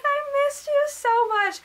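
high, slow, stretched-out wail; acted tearful-mom voice; emotional reunion. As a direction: imitate an overcome mother, drawing it out.

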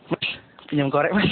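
low, soft, gentle ask for a light; a Medan man gently asking a stranger for a lighter; softest request. Retold or imitated. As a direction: ask for a lighter as sweetly as you can. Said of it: "Lembut selembut-lembutnya".

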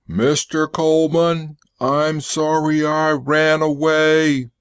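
high, monotone robot apology; infantile robot pleading. A narrator's reading. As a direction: apologize like a simple childlike robot, evenly pitched and drawn out. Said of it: "his voice rolled like thunder".

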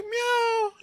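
high squeaky mock meow; cat meow. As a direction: mew like a little cat. Cat meowing in retold scene.

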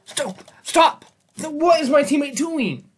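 high shouted furious yell; raging gamer. Real, not acted. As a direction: scream it at the teammate.

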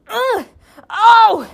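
high strained grunt and groan; straining to reach something; effortful grunt. Acted.

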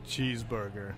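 deep, hushed crooner voice. Pretend. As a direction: drop it very low and soft, like a soul singer.

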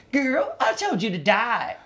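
high, slow, put-on deadpan drag voice; Lady Bunny impression. Lady Bunny correcting the retold advice, high slow put-on voice. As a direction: drop the punchline as the older queen. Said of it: "she says".

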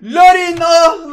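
bursting out as the striker breaks through; excited yell. Real, not acted. Gamer yelling at his striker, excited and loud. Gamer cheers a player's chance.

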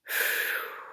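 voiceless calming exhale; deep breath out. Acted. Ava composes herself before comforting mother, exhaling deeply. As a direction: blow out a long breath. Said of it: "let out a deep breath".

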